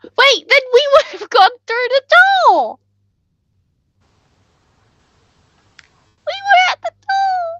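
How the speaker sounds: high, squealing objection; indignant protest. Real, not acted. Player objecting they were at the door, indignant squeal. Protesting the game master's account.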